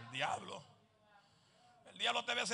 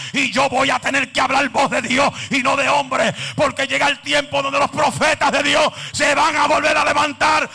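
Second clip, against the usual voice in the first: loud, driving preacher's shout, higher; preaching at full force; 6 semitones higher, 16 dB louder than usual. Acted. Preacher rousing the congregation with a loud, fiery shout. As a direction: preach it like fire burning inside.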